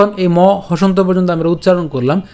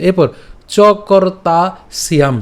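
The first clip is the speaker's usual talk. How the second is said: normal talking voice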